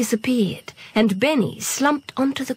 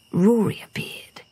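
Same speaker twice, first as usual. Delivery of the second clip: ordinary delivery, nothing marked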